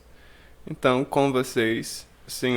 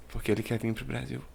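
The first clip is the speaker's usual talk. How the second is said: quiet aside; 11 dB quieter, faster than usual. Acted. Teasing echo of his own question.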